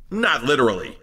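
dropped low after the hype; low, understated comic aside; deadpan aside. Acted. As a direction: toss it off as a low disclaimer.

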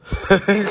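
short loud cackle; loud laugh. Real, not acted. Teasing unsmiling mum, a loud laugh. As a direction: burst out laughing loudly.

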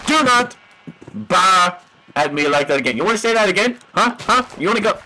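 high, rapid-fire goading; mock confrontation. Player challenging red sheep that bah at him, goading. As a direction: pick a playful fight, pushing each challenge.

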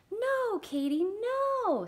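high, loud, slow no; scolding. Acted. Girl scolds the dog again, high, loud and slow. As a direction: drag out the telling-off. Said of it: "said Sarah Ann".

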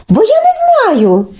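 put-on high child-like pleading voice; naive Telesyk. Telesyk asks Olenka to show him, high and naive. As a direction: innocently ask to be shown. Telesyk feigns not knowing how.

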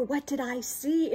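plain reading voice

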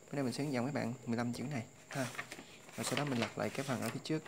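ordinary talk, nothing marked

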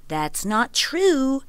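a huffy, drawn-out protest; indignant protest. A narrator's reading. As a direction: drag out the denial, offended. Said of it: "countered Wind".

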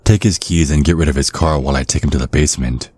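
ordinary talk

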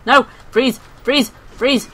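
loud, sharp one-word bursts; urgent commands. Real, not acted. Player yelling at enemies in the game, urgent commands. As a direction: call out urgent orders in a fight.

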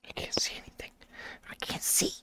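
hushed, breathless whisper. Real, not acted. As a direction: whisper scared in the dark. Blinded in the dark game.

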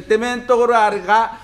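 ordinary delivery

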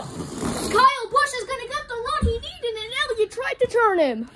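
high, urgent play-by-play; excited race call. Real, not acted. Commentator watching one car try to turn another, excited.

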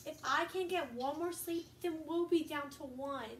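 a steady read-aloud storybook voice; reading to children. Acted. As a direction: read it to the kids like a bedtime story. Reading picture book aloud.

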